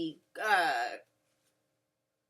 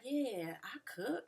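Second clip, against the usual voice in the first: creepy flirt; 11 dB quieter, slower than usual. Retold or imitated. Cisco answering Mo about cooking, soft creepy flirt. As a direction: lay it on smooth and quiet. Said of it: "the creep came out".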